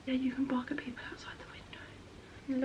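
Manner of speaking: soft whispered aside; whisper to dog. Real, not acted. Owner to her dog, whispering softly. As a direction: whisper it gently to the pet.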